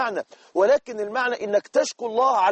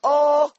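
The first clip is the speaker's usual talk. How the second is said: mock pained groan, louder and higher; 7 semitones higher, 4 dB louder, much slower than usual. Shown as a demonstration. Preacher imitating a patient's groan, mock pained. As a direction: let out a demo sigh of pain. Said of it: "أنت حتى لما تقول".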